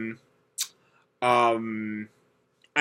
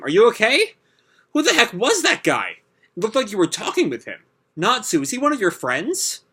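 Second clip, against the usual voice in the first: a bit higher, lightly voiced concerned friends; lightly voiced worried teammates; 7 semitones higher than usual. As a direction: mildly voice anxious teammates. Teammates voiced in anime recap.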